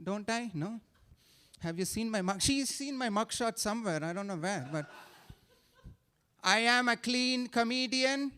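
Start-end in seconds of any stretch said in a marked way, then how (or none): none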